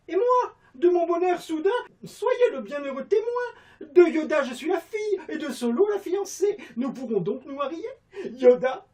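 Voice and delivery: very high, loud theatrical falsetto; hammed-up high classical heroine; Molière theatre style. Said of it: "à la Molière".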